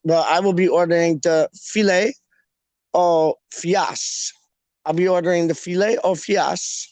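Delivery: filet au fiash, mock-French; posh pronunciation. Diner orders at Macadonalds, posh "filet au fiash". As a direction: order grandly, fish stretched to "fiash". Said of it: "Make it sound posh".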